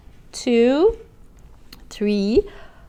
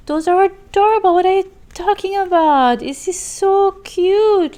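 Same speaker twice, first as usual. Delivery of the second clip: much higher, louder, gushing; squealing delight; 10 semitones higher, 4 dB louder than usual. Real, not acted. Thanking a viewer for her gift, high gushing squeal. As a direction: gush over the gift, stretching cute.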